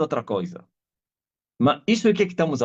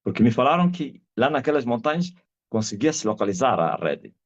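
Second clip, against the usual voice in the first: usual speaking voice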